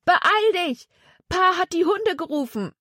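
high-pitched warning cry; urgent yell. A narrator's reading. Jonathan warns Zack the dogs are coming, urgent yell. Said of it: "schrie er".

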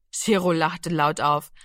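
plain reading voice

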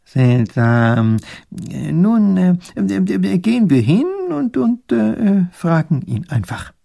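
high, stammering professor voice; puzzled old professor. A narrator's reading. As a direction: puzzle it out haltingly, full of hesitations. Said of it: "Pfiffikus wundert sich auch".

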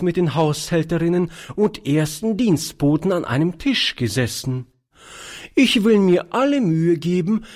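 high-pitched, self-important aunt; altes Fräulein. Acted. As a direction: speak up high as a proper old aunt. Voices old aunt Charlotte. (hohe Stimme einer wichtigtuerischen Tante)

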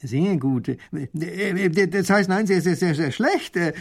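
flustered, stuttering correction; stammering baron. Acted. Baron stammering his praise into blame. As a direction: trip over his words. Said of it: "stottert der Baron".

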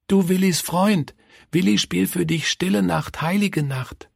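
put-on high, simple child's voice; eager child. A narrator's reading. Willi offers to play harmonica for dying Kurt, eager child.

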